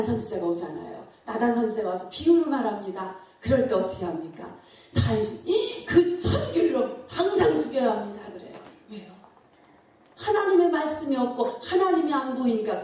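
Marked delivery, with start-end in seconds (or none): 5.5-8.2 higher-pitched retold outrage; indignant outburst. King David reacting to Nathan's parable, indignant. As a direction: condemn the culprit on the spot.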